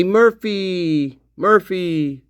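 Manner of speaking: long drawn-out call for a dog; imitated calling out. Imitating officers heard calling the dog Murphy, calling out. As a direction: stretch the name as if calling the dog. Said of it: "hear them in the background say Murphy".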